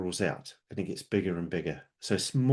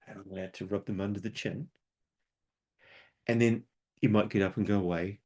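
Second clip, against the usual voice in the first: ordinary delivery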